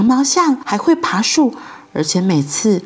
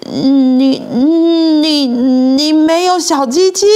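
loud, drawn-out stunned stutter; stammering; 3 semitones higher, 4 dB louder, slower than usual. A narrator's reading. Dumbfounded boy discovers the truth about his friend, stammering in shock. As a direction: stumble on the first word, then blurt it out. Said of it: "他结结巴巴地说".

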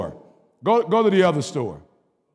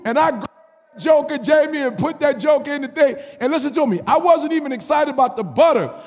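higher, louder, building shouts; full-force preaching; 8 semitones higher, 4 dB louder than usual. Real, not acted. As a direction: preach it to the rafters.

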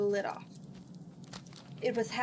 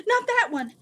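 high, loud burst of alarm; quick urgent warning; 12 semitones higher, 9 dB louder than usual. A narrator's reading. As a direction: cut in sharply to stop someone. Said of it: "she said quickly".

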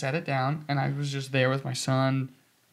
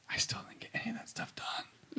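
hushed, breathless whisper; 8 semitones higher, 13 dB quieter than usual. Retold or imitated. Telling friends about late-night work, whispered. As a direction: whisper it like a worn-out confession. Retelling staying up overworked.

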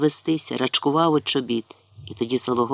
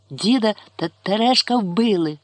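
higher, gulping words; choking back tears; 6 semitones higher, 4 dB louder than usual. A narrator's reading. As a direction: force the words out through tears. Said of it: "ковтаючи сльози, давлячись словами".

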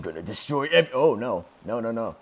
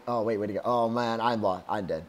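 normal talking voice

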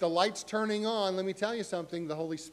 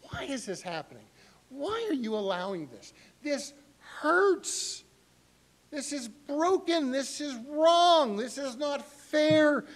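higher and louder, slow and pained, building; anguished protest; 9 semitones higher, 6 dB louder than usual. Retold or imitated. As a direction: pour out hurt at God. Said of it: "where you've said".

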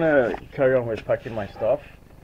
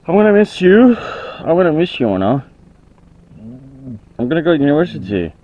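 loud farewell; 5 semitones higher, 11 dB louder than usual. Real, not acted. Farewell to her dog.

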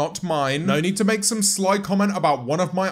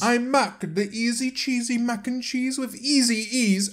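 mocking sing-song; 6 semitones higher than usual. Mocking parody of the phrase.

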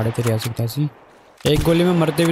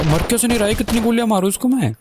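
frustrated outburst; 8 semitones higher, faster than usual. Real, not acted. Enemy won't die, frustrated gamer.

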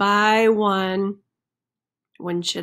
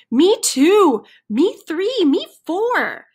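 high, louder chorus of chickens; eager chickens chiming in; 8 semitones higher, 5 dB louder than usual. Acted. Chickens agree with friend they are scared, high and eager. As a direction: pipe up one after another, high and keen.

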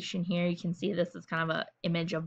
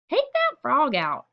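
high, slow, firm order; bossy sister giving a slow order; 6 semitones higher, 3 dB louder, slower than usual. A narrator's reading. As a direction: lay down the law to her brother. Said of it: "ordered Fern".

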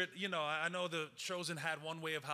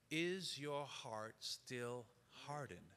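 slow, hushed and much softer; soft solemn question; 2 semitones lower, 8 dB quieter, much slower than usual. Acted. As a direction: ask it gently, almost under the breath. Solemn scripture quote, very soft.

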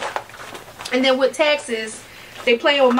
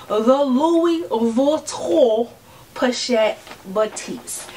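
slow luxe announcement; much slower than usual. Acted. Slow fancy name reveal.

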